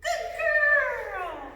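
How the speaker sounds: high, loud, delighted praise; happy praise voice. Real, not acted. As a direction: gush over the dog. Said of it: "Happy voice".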